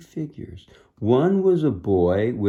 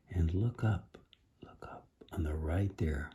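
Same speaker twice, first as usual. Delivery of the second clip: hushed aside to the listener; soft unvoiced whisper pointing at the picture; 4 semitones lower, 12 dB quieter than usual. Real, not acted. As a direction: whisper it like sharing a secret. Whispering to children over picture.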